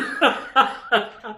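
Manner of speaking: giggling fit after an apology; helpless laugh. Real, not acted. Host apologizing to viewers, giggling helplessly. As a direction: let the laugh run out. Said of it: "I'm still laughing in my head".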